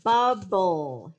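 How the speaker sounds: "bubble" loud and stretched, BUB-bull; "bubble" slowly and clearly, falling; dictated word. Shown as a demonstration. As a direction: punch both syllables of "bubble". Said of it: "Let's do".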